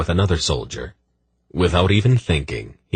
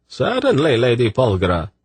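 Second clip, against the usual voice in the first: higher silky male voice; smooth eunuch voice; 7 semitones higher than usual. A narrator's reading. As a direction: purr it politely.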